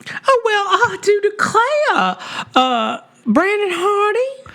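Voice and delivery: high, slow, put-on Southern drawl; hammed-up Southern accent, high and slow. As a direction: play a genteel Southern host calling names. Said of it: "we should have done these all in Southern accents".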